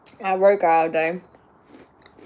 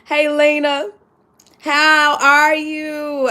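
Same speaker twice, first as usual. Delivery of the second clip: cheery greeting; 5 semitones higher, 6 dB louder, much slower than usual. Retold or imitated. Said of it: "I was just telling my friend".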